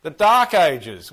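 slow and loud, every word of "The Dark Ages" drawn out and pressed; emphatic repeat. Real, not acted. Teacher echoing a pupil's right answer, slow loud emphasis. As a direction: proudly echo "The Dark Ages" slowly, punching "Dark".

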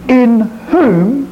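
"in whom" lifted high and set apart; pause, then raise "in whom"; emphasis on in whom. Shown as a demonstration. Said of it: "I will emphasise certain words".

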